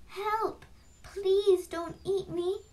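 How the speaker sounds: high, drawn-out plea; frightened shout. A narrator's reading. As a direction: cry for help as a scared goat. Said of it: "the brother goat shouted".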